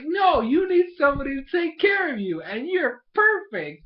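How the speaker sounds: high, loud, hammed-up little sister; mock insistence. Little sister insisting her brother needs someone, loud mock pleading. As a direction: insist at the top of your voice, stretching the end.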